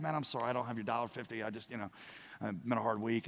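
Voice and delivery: low, soft, sheepish excuse; meek apology. Acted. Buddy who owes a dollar fifty, meek apology. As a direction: shrink back and apologise quietly.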